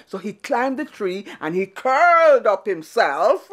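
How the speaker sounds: usual speaking voice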